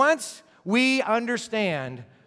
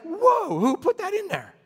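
whoa, pitched much higher; mock surprise; 12 semitones higher than usual. Reformers reading Romans, mock shocked surprise. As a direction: gasp like you just discovered it. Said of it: "they're like".

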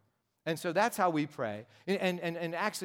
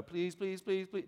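high, rapid-fire pleading on one note; begging small child; 4 semitones higher, slower than usual. Acted. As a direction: pester like a little kid begging. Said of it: "whenever your children are small".

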